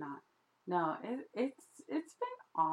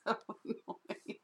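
breathy laugh through words; 5 semitones higher than usual. Real, not acted. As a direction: giggle it out. Agrees while laughing.

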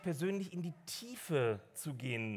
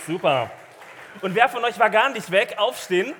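anfeuernd rufen; 3 semitones higher, 10 dB louder than usual. Real, not acted. Feuert die Gemeinde lautstark an.

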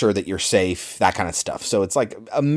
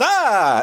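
high startled yell, a touch louder; mock scream; 11 semitones higher, 3 dB louder than usual. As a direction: yelp in fright at the touch. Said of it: "And then Johnny Depp is".

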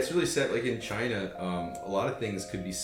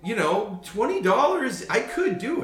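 naive tourist; 12 semitones higher, 6 dB louder, slower than usual. Retold or imitated.

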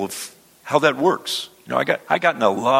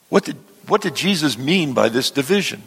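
normal talking voice

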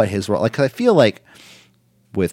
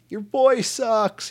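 heckling fan; 13 semitones higher, much slower than usual. Retold or imitated. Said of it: "I'm like".

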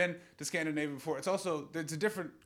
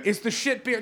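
mock echo; 8 semitones higher, 3 dB louder than usual. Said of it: "repeats audience member".

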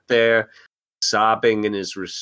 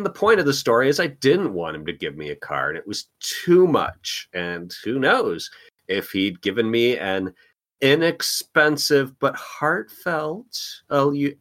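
normal talking voice

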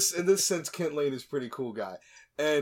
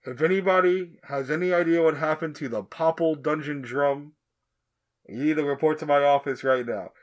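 a stern principal over the school speakers, louder; principal on announcements; 7 dB louder than usual. Retold or imitated. Retelling the principal's announcement to co-hosts, mock stern PA voice. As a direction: bark it like a principal on the PA. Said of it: "The principal goes".

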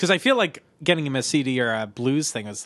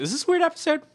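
mock high voice; 12 semitones higher, much faster than usual. Real, not acted.

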